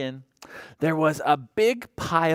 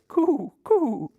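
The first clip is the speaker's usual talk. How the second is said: high cooing coo coo; pigeon coo; 12 semitones higher than usual. Acted. Storyteller coos as the pigeon. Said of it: "The pigeon says".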